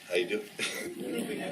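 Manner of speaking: low, soft, very slow come-on; mock smooth pickup voice, drawn way out; hammed-up flirting. Husband hitting on his own wife at the bar, hammed-up seductive.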